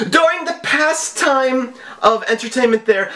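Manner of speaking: high, theatrical villain read of the email; mock scammer voice. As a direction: read the scam letter like a hammy hacker. Reading scam email in mock voice.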